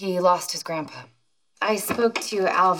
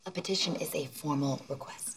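low, hushed explaining; explanatory aside; 7 semitones lower, 10 dB quieter than usual. Acted. Daughter explaining legal terms to her mother, hushed aside. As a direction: slip in the definition softly, pitched down.

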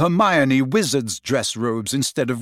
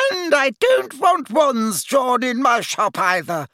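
high, slow, raised protest from a fussy seamstress; hasty shopkeeper; 11 semitones higher than usual. A narrator's reading. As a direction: blurt it out in a flustered hurry. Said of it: "she added hastily".

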